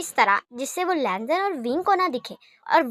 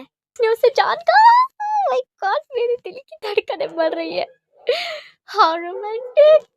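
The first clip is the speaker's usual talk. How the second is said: much higher and louder, slow gushing squeal; excited squeal; 12 semitones higher, 8 dB louder, slower than usual. Retold or imitated. Girls eavesdropping on the friends, squealing with excitement. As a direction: swoon out loud like a thrilled fangirl. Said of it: "excited होते हुए कहती हैं".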